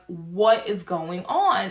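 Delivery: slow bewildered. Retold or imitated. Retold bewildered question.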